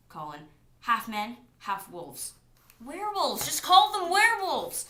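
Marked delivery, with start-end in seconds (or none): 2.8-4.9 loud, high, slowed-down outburst; exasperated shout. Real, not acted.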